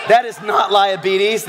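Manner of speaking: laughing that's not liabetes; laughing rebuke. Real, not acted. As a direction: laugh it out in playful protest.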